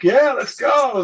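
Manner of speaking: high, loud, eager call; re-enacted rallying cry. Fighter rallying his new bar friends, eager re-enacted call.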